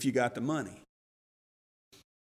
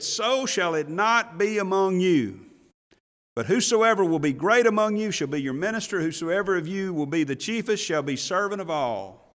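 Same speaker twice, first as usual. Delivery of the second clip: preaching scripture; 4 semitones higher, 5 dB louder than usual. Acted. Preacher reading Jesus' words aloud, proclaiming. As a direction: proclaim the verse to the congregation.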